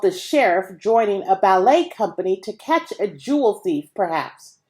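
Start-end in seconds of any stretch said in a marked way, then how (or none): none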